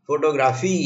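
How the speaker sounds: Photography with final फी stressed and stretched; stress on last syllable. Shown as a demonstration. Teacher showing four ways to say a word, stressing फी in photography. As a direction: save the weight for फी at the end of photography. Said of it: "मैं चारों तरह से शब्द बोल सकता हूँ".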